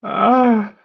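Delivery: high, drawn-out "ah" of disbelief; exasperated wail. Real, not acted. Fan despairing at Saka still playing, high exasperated wail. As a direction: let out a high, pained groan. Despair over Saka's performance.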